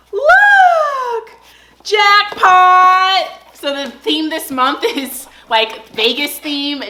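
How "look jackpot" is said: very high, loud gasp then shout; excited squeal. Real, not acted. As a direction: shriek with delight at the prize. Revealing dog box contents.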